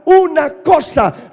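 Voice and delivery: loud slow call for the echo; call and response. Shown as a demonstration. Pastor calling the church to repeat, loud. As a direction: drive it home so they shout it back. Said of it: "Repita conmigo y diga".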